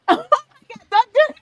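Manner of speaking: normal talking voice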